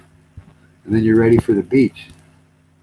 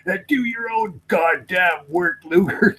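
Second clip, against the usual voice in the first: higher, slow mock-stern character voice; stern mind reader; 8 semitones higher, slower than usual. Mind reader scolding Luger in the skit, mock stern and slow. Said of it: "with a real stern face and he goes".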